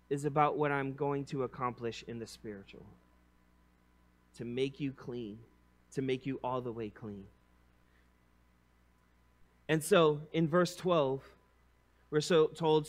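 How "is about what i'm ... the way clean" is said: softer, lower, tender; tender assurance. Acted. Jesus tenderly explaining to Peter why, hushed. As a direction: draw them close and reassure.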